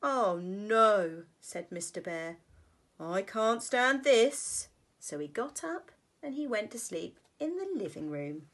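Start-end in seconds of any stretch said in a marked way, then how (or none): 0.0-1.3 Mister Bear groaning. Acted. Mister Bear's weary refrain. 3.0-4.7 slow exasperated bear. Acted. Mister Bear's exasperated refrain.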